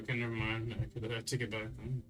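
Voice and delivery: normal talking voice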